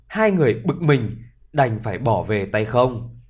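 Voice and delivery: ordinary delivery, nothing marked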